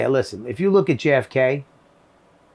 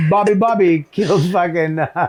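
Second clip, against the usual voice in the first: laughing; 3 semitones higher than usual. Real, not acted. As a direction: crack up while saying it. Joking about doubled names.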